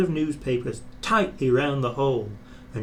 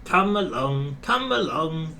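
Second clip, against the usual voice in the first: puffing engine; 4 semitones higher than usual. A narrator's reading. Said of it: "he puffed".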